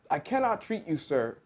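high-pitched, restrained calling out; mild narrated shout. A narrator's reading. Dentist refusing a fox at his door, mild shout. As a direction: call it out firmly, voice lifted. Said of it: "Doctor DeSoto shouted".

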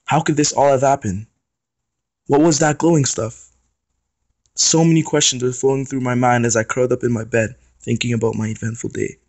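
ordinary delivery, nothing marked

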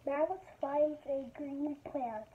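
slow, careful picture-book reading; reading to children. Acted. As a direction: read each page slowly to kids. Reads picture book aloud.

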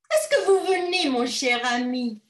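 high, loud call to her husband. Acted. Wife calling her husband, high and loud. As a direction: call across the room, sweetly.

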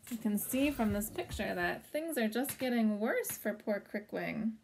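usual speaking voice

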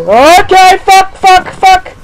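very high loud frightened yells; frantic high-pitched bursts; panicked screaming. Real, not acted. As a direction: shriek in sudden terror. Sudden fright in game.

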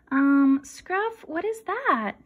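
high bemused question; puzzled Jasper. Acted. Jasper puzzled by the pirate hat, high and bemused. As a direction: ask in disbelief.

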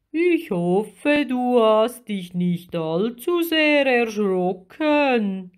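slow, swooping big creature voice; huge but kindly, vowels drawn out; friendly giant octopus. A narrator's reading. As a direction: reassure like a friendly sea giant. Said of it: "sagte er und stupste Lilli freundlich".

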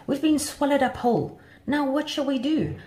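high-pitched storybook dismay; a mildly panicked butterfly's cry; alarmed shout. A narrator's reading. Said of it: "shouted Butterfly Sue".

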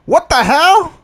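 high-pitched, loud disbelief; incredulous outburst. Real, not acted. Player shocked at losing the race, incredulous. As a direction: cry out in outraged bafflement, voice shooting up.

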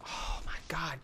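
breathless whispered oh my god; hushed disbelief under the breath. Real, not acted. Gamer whispering in shock at the screen.

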